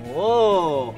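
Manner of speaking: ordinary talk, nothing marked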